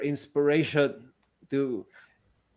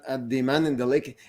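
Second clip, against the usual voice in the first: ordinary talk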